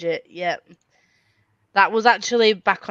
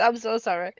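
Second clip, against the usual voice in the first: usual speaking voice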